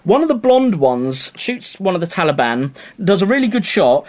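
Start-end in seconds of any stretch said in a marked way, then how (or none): none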